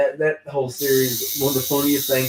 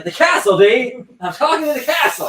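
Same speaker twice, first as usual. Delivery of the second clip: insistent; 11 semitones higher, 8 dB louder than usual. Retold or imitated. Sick character's crazed reply acted out.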